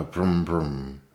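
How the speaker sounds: slow, nervous little brum brum; a mouse trying a motor sound, worried it squeaks; timid engine noise. A narrator's reading. Mouse on a toy motorcycle, nervously making a timid engine noise. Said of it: "fearfully lest his noise be too squeaky".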